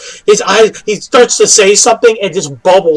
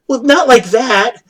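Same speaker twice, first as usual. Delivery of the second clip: laughing and shouting through a joke; 8 semitones higher than usual. Real, not acted. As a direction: crack up while talking.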